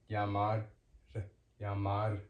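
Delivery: "llamar" ending in one quick tongue tap; tapped R. Shown as a demonstration. Teacher showing one R to learners, "llamar" with a quick tap. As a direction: tap the final r of "llamar" once, no roll. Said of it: "Quick tap".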